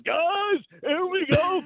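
high, excited play-by-play call; Steve Cooley. Retold or imitated. Analyst mimics a colleague on air, excited high announcer call. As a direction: imitate a sportscaster calling breaking news. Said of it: "listening to Steve Cooley's".

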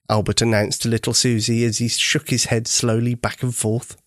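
usual speaking voice

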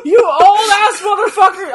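high, loud yell through laughter; laughing yell. Real, not acted. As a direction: yell it out, cracking up. Laughing disbelief at the veterans.

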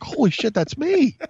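high-pitched shocked exclamation; stunned. Retold or imitated. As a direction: blurt it out in high disbelief. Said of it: "I'm like".

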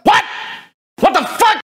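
high, loud shocked what the fuck; shocked outburst. Real, not acted. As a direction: blurt it out in disbelief. Outraged disbelief at the story.

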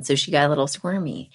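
ordinary delivery

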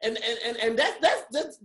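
stammering, stuck on the same little words; mock stutter. Debater winning hands down, then stuck in a stutter. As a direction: lose the argument by stuttering, pitch climbing. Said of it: "but you be like".

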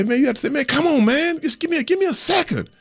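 asking the linemen for time, pitched well up; pleading with linemen. Retold or imitated. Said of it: "sometimes you like say".

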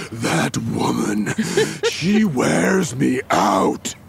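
slow, drawn-out exclamation with a laugh; exasperated, stretch every word out; loud exasperated exclaim. Acted. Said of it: "he loudly exclaims".

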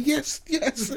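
laughing agreement. Retold or imitated. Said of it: "I was like".

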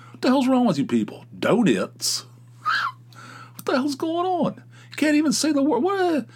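rising outrage at people who can't order; exasperated rant. Real, not acted. Ranting about customers who can't say donuts, high and exasperated.

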